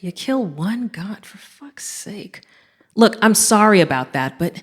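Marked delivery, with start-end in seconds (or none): 0.0-2.4 whispered curse under her breath; muttered curse. Real, not acted. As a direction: breathe it out, exasperated, barely audible. Muttered complaint after killing god.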